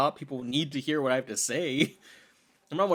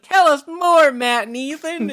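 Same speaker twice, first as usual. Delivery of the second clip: put-on very high, loud child-audience voice; hammed-up squeal of adoring listeners; eager kids at story time; 13 semitones higher, 12 dB louder, slower than usual. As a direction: beg like an excited child. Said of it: "story time when you were a kid".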